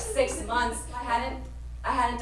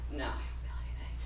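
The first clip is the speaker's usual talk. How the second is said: whisper; 7 semitones lower, 9 dB quieter, much faster than usual. Retold or imitated. Whispered reply, stoned friends.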